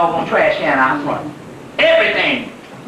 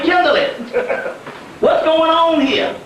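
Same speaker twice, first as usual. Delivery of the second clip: exasperated complaint; 6 semitones higher than usual. Retold or imitated. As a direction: plead with her, baffled and stretched out. Complaining to Kimberly on phone.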